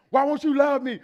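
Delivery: loud, high hammed-up plea for love; mock needy plea. Husband mad his apology was refused, loud mock pleading. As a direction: play the wounded husband, loudly demanding affection. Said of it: "you gonna say it like that".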